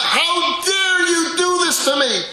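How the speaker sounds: put-on high, loud, furious child's voice; a toddler raging at mom; angry child.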